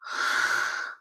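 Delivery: a breathy exhale through both nose and mouth; breath through nose and mouth. Shown as a demonstration. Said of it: "If I breathe like this".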